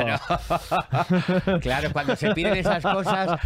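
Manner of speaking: words bubbling out on real laughter; laughing. Real, not acted. Medium laughs telling the host about ghost requests, laughing. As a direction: laugh while you keep talking. Laughing at her own joke.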